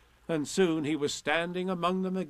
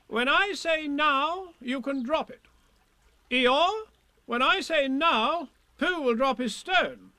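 high-pitched bossy commands; raised, emphatic organiser voice; Rabbit; 13 semitones higher, 6 dB louder, slower than usual. A narrator's reading. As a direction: take charge officiously. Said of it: "it was time he took command".

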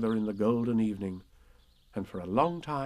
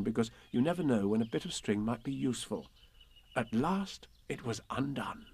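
ordinary delivery, nothing marked

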